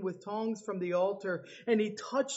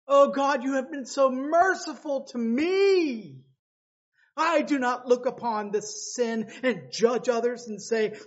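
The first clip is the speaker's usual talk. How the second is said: mock self-righteous prayer; 4 semitones higher, 5 dB louder than usual.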